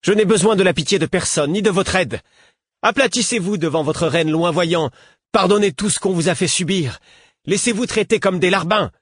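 high-pitched, contemptuous tirade; spitting scorn. A narrator's reading. Woman rejecting their pity and help, scornfully spitting. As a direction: hurl each order at them with scorn. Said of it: "cracha Mercureuil".

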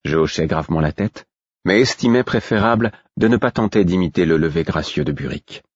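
ordinary delivery

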